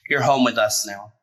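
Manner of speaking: ordinary delivery, nothing marked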